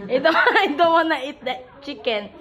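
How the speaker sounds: talking through laughter; words breaking into laughs; laughing. Real, not acted. Laughing at her prank.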